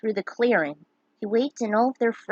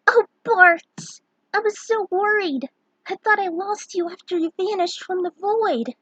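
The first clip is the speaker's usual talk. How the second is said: put-on high, bright girl's voice, relieved; a young woman overjoyed to see him; Lena's relieved greeting; 10 semitones higher, 4 dB louder than usual. Lena greets Bart.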